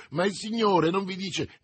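high, agitated objection; exclaimed protest. A narrator's reading. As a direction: start explaining out loud. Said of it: "esclamò il merciaio". (protesta concitata)

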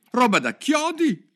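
loud, high outburst of indignation; indignant exclamation. A narrator's reading. Alice reacts to the jurors out loud, indignant cry. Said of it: "si indignò Alice a voce alta".

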